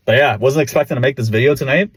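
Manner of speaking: ordinary talk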